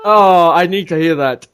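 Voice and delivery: normal talking voice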